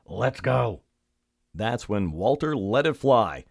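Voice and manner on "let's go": burglar. A narrator's reading. Second burglar to partner with the loot, put-on crook voice. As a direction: announce the haul in a crook's character voice.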